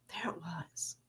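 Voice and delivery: breathy whisper, unvoiced, spoken close; whispered realization. Shown as a demonstration.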